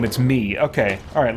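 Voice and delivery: ordinary talk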